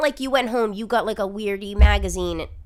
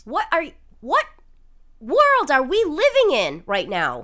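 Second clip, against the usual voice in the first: exasperated; 5 semitones higher, 7 dB louder than usual. Real, not acted. Outraged at TikTok.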